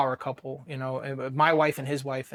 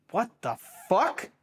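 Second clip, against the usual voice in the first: drawn-out, higher stunned what the fuck; stunned disbelief; 5 semitones higher, slower than usual. Retold or imitated. Veteran reliving the moment his wife says she's pregnant, stunned and slow. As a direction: let the shock hang, slow and rising.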